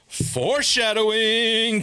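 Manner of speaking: slow, drawn-out knowing remark. Retold or imitated. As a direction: stretch the word out, knowingly. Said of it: "And I was like".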